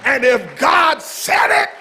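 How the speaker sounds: fervent preacher's proclamation; full-force preaching. Real, not acted. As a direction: preach it up to a climax.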